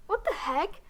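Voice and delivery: jolted up high and loud, caught off guard; shocked outburst. Acted. Alien doctor shocked when specimen vomits on her. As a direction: yelp it the moment it hits you.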